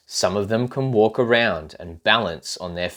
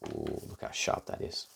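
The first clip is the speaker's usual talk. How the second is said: softly whispered ooh; hushed aside; 11 semitones lower, 14 dB quieter than usual. Real, not acted. Showing the child the sharp sword, whispered.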